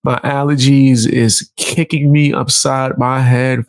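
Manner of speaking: ordinary delivery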